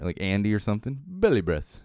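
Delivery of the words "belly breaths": mock British accent. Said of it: "a dude with a British accent that's on there".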